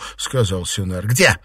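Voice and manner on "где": abrupt, loud question; interrupting. A narrator's reading. Mistress breaking into the servant's account, interrupting. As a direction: cut in with a raised voice. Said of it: "перебила его Фэнзе".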